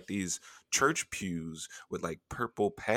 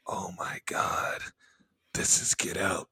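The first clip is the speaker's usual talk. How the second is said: breathless slow whisper of dawning dread; panicked whisper; slower than usual. Retold or imitated. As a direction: whisper the horror realization.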